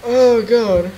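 high, loud protest after a scare; rattled shouting. Real, not acted. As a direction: yell it, still shaken by fright. Rattled right after screaming at scare.

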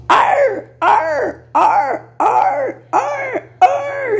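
very high, yelping barks; dog bark. Shown as a demonstration. Woman showing how the country dogs bark, high yelps. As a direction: yap like a small dog. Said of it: "latem assim".

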